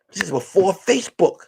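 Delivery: ordinary talk, nothing marked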